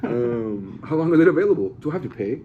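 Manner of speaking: high, loud, eager naive asking; mock clueless viewer. Mocking a clueless viewer asking a friend about Netflix, high and eager. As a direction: play a dopey customer with too many questions.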